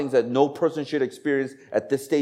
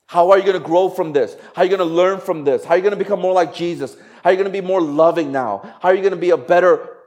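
louder, faster, higher-pitched rallying questions; preaching fervour; 5 semitones higher, 5 dB louder, faster than usual. Real, not acted. Preacher challenging the church to grow, raised and fast. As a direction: press each question harder, driving them home. Preaching climax, rising intensity.

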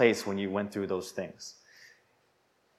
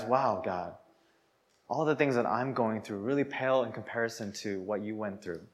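grateful prayer; 3 semitones lower, 8 dB quieter than usual. Acted. Acted grateful prayer to God.

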